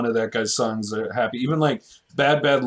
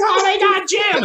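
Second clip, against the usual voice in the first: high-pitched shriek of a fan; screaming fan; 3 dB louder than usual. Retold or imitated. Fans at a concert, high screaming fan. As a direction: shriek his name like a starstruck fan. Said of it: "all the fans are screaming".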